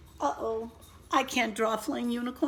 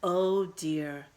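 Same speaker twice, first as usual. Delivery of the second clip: ordinary talk, nothing marked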